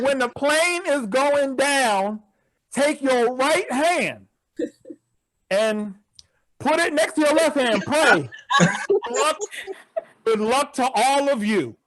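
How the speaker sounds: slow, drawn-out safety talk; slow deadpan instructions. Retold or imitated. Flight attendant gives passengers the crash plan, slow and deadpan. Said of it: "she said".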